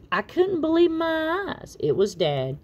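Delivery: ordinary delivery, nothing marked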